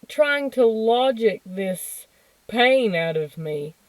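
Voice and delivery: normal talking voice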